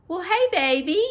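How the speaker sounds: high, loud sweet greeting; affectionate greeting. Retold or imitated. Mom greeting her daughter at the door, fond and loud. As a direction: greet your daughter lovingly. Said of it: "I may say".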